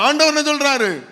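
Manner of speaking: ordinary talk, nothing marked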